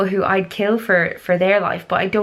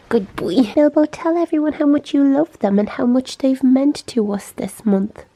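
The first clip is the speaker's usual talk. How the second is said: pet talk; 6 semitones higher, 3 dB louder than usual. Real, not acted. Talking to the dog.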